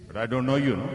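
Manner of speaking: retold rude retort; imitating a dismissive man. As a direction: fling it back at him, curt. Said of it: "He says".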